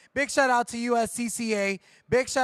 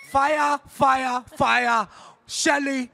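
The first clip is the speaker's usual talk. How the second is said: loud, higher mock Jamaican hype; bad Jamaican accent; 4 semitones higher, 5 dB louder, slower than usual. Cheering Shelly on with fire, shouted bad Jamaican accent. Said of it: "most worst Jamaican accent".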